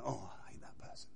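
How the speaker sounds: hushed whisper behind a back; whispered aside. Retold or imitated. Once out of company, coworker whispers contempt. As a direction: whisper the spite so none hear. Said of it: "they're going".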